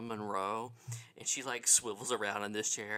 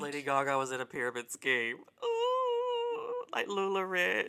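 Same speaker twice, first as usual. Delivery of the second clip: excited; 10 semitones higher, 8 dB louder than usual. Real, not acted. Excited gossip about scheme.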